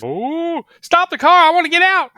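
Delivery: very high, loud imitation of panic; panicked yelling. Tommy sees his mom and begs driver to stop, panicked mock yell. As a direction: yell it high, rising from a worried "oh".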